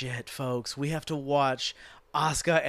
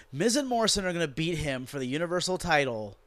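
ordinary talk, nothing marked

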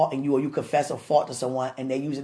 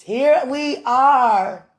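emotional cry out; 8 semitones higher, 10 dB louder, much slower than usual. Real, not acted. Emotional live address to viewers.

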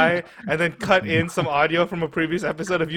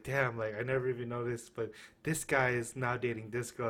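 quoting coworker, softly; 3 semitones lower, 13 dB quieter than usual. Retold or imitated. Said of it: "I've literally heard".

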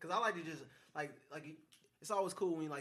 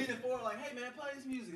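mock passenger piping up, higher pitch; lightly put-on higher voice asking the driver; imitated passenger request; 9 semitones higher, much slower than usual. Passenger asking the driver for music, mock request.

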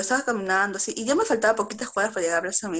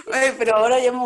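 laughing; 3 semitones higher, 7 dB louder than usual. Real, not acted. Laughing defense.

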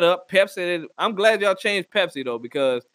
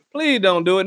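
ordinary delivery

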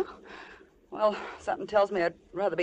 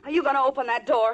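loud, raised-pitch challenge; angry demand; 4 semitones higher, 6 dB louder than usual. Acted. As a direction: demand it loudly from your captor. Trapped, confronts the captor.

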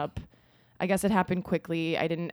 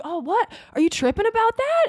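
high-pitched incredulous question; imitated surprise; 4 dB louder than usual. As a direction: pitch it way up, retelling someone's disbelief. Retells boyfriend's surprised reply.

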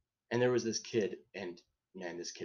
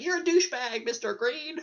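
high, loud yell of the insult; pissed-off kid shouting at the teacher; angry outburst; 6 dB louder than usual. Retold or imitated. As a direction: blurt it out in a fit of anger at the teacher. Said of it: "Billy got pissed off and he's like".

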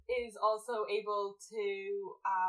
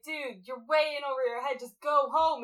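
exasperated; 4 semitones higher, 6 dB louder than usual. Retold or imitated. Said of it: "you're just like".

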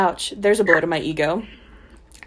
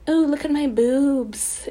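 slow, higher teasing mock-seductive; flirty mock voice; 7 semitones higher, slower than usual. Friend mocking the heroine's flirting in a slow, higher voice. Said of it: "I feel like she's like".

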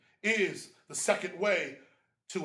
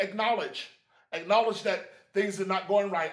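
ordinary talk

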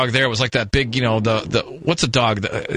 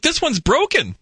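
high incredulous; 11 semitones higher than usual. Retold or imitated. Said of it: "And I'm like".

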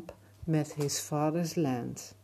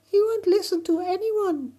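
very high, loud tiny voice; ladybug voice; 8 dB louder than usual. Acted. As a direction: squeak like a tiny insect. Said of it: "a ladybug said in insect talk".

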